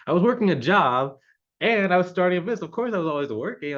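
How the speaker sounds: exasperated self-defense. Retold or imitated. Entrepreneur answering a spouse's old complaint, exasperated. Reliving complaint about always working.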